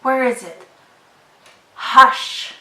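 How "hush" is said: showing the sh in hush. Shown as a demonstration. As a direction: hush ending in a long hushed sh. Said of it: "Do you hear the S H sound here".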